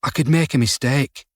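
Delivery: usual speaking voice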